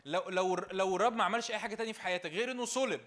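loud, fast, rising sermon delivery; full-force preaching. Acted. Preacher rousing a joyless congregation, loud fervent preaching. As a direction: build to a fervent preacher's cry.

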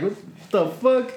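high, drawn-out incredulous protest; retold disbelief. Walker reacts to little girl's remark, slow high mock disbelief.

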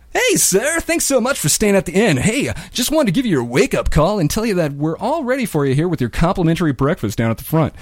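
high, bright front desk voice; cheery hotel clerk. Retold or imitated. Prank wake-up caller to sleepy guest in cheery clerk voice. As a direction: chirp it like a helpful clerk. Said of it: "he's still in that same voice".

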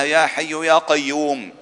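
ordinary talk, nothing marked

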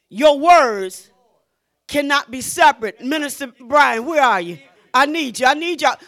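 ordinary talk, nothing marked

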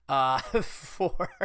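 breaking into laughter mid-plug; cracking up while reading an ad; laughing fit. Real, not acted.

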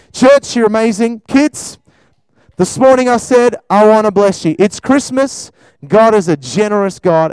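loud, high and bright address to the church; rally the congregation loudly; excited preaching. Real, not acted.

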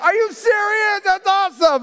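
high, laughing disbelief; delighted mock amazement. Father to young son, laughing mock amazement. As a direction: pretend to be amazed by the kid's lesson. Dad reacting to son's lesson.

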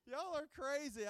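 laughing, slowed. Real, not acted. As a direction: laugh it out fondly. Laughing at crowd's response.

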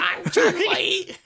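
put-on high, slow old lady voice. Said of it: "this old lady".

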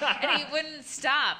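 giggling through the line; laughing. Real, not acted. Laughing while retelling.

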